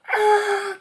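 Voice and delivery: loud, very high acted scream; piercing "ah" shriek; victim's scream. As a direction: scream like someone about to be eaten. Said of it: "This guy screams".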